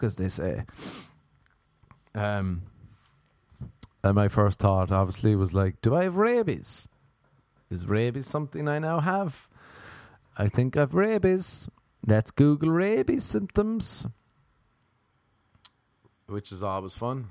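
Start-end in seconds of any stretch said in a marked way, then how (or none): 5.8-14.1 high-pitched rising fretting; mock worry. Man bitten by a dog wondering about rabies, comically worried. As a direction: play up the mounting rabies worry. Acts out worried thoughts after bite.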